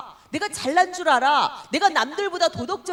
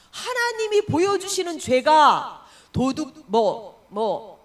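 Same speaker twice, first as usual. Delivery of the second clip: usual speaking voice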